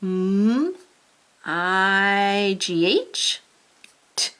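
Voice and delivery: letters drawn out very slowly; slow spelling. Shown as a demonstration. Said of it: "Here, spell it".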